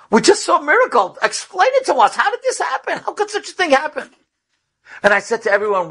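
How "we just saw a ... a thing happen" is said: amazed pleading. Retold or imitated. Women amazed at miracle.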